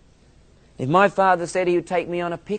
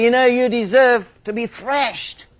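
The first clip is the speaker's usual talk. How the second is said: higher and louder stern rebuke; mock stern father; 6 semitones higher, 5 dB louder than usual. Father telling the boy he deserves thrashing, mock stern. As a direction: scold like the father.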